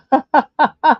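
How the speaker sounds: short loud laugh; burst of laughter. Real, not acted. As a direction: let out a quick real laugh. Laughs at chat comment.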